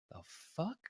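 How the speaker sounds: barely audible, baffled question; re-enacting quiet, dumbstruck confusion; stunned whisper. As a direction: say it under your breath, rising in disbelief. Stunned reaction to her falling.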